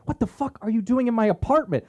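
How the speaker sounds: high-pitched outraged woman; indignant, high-voiced confrontation; fuming mad. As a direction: act out her furious demand, high. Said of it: "fuming mad".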